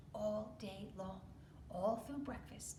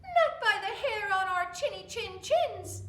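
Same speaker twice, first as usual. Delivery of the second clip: much higher, louder little pig; squeaky defiant pig; 10 semitones higher, 10 dB louder than usual. Acted. Little pig defying the wolf through the door, high and squeaky. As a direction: squeak out the refusal. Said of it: "the two little pigs said".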